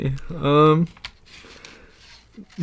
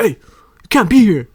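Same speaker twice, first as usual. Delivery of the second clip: high, loud, drawn-out call; imitated challenge shout; 7 semitones higher, 9 dB louder, slower than usual. Imitating a guy who comes up in town, confronting shout. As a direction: shout it like a stranger warning someone off. Stranger confronts him in retold story.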